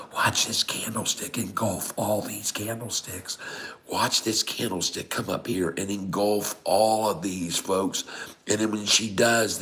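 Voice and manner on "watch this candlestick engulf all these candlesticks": soft conspiratorial whisper. Shown as a demonstration. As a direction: lean in and whisper the tip. Whispered, then repeated in plain voice.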